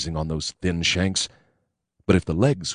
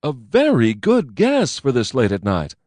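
cheerily; 6 semitones higher, 4 dB louder than usual. A narrator's reading. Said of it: "I told him cheerily".

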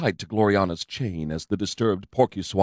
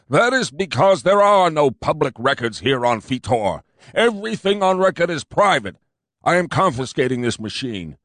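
higher, louder officious cop; pompous fat cop; 5 semitones higher, 4 dB louder than usual. A narrator's reading. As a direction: lay down the law smugly.

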